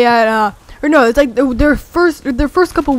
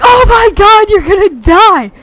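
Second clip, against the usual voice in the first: high, loud frantic shout; yelling in alarm as a friend darts into traffic; panicked yell; 7 semitones higher, 7 dB louder than usual. Retold or imitated. Kids yelling at a friend running across the street, panicked.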